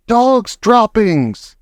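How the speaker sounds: high, slow drawn-out yell of Dog's droppings. A narrator's reading. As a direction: stretch out the yell. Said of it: "she yelled".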